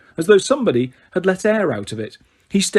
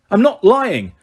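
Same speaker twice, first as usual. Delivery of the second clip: louder, insisting he's not lying; loud protest; 6 semitones higher, 5 dB louder, slower than usual. A narrator's reading. Harry defending himself to the court, loud protest. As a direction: push back hard against the accusation. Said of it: "said Harry loudly".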